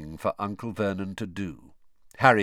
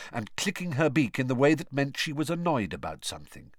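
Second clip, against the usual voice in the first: ordinary delivery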